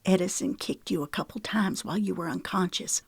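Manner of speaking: ordinary delivery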